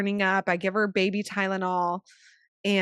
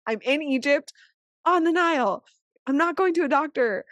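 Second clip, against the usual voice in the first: high, louder retold protest; exasperated explaining, pitched up; insistent, raised voice; 7 semitones higher, 4 dB louder than usual. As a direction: push each phrase down a bad phone line. Insisting to doctor over bad line.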